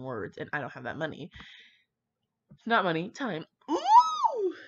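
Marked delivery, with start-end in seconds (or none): 3.7-4.5 very high, loud ooh; delighted shriek; excited squeal. Real, not acted. Reacting to a fanfic find.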